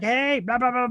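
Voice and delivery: high, chattering brat impression; self-mocking brat. Brat butting into a friend's live chat, playful high babble. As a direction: mimic yourself pestering, pitched right up. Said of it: "I'm such a brat that I'll be like".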